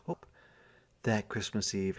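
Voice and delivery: ordinary delivery, nothing marked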